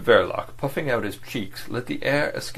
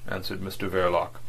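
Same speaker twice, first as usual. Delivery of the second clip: usual speaking voice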